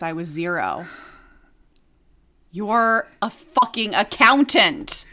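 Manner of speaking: ordinary talk, nothing marked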